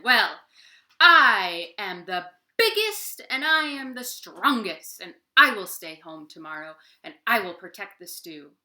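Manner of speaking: loud, booming brave lion; proud lion boast. Acted. Lion promising the animals to guard the stew, loud and proud. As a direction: puff up like the strongest animal.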